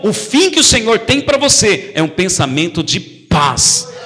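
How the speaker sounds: normal talking voice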